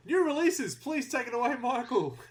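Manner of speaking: high, loud hype-up; excited. Real, not acted. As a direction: announce it with a big lift. Hyping up the co-host.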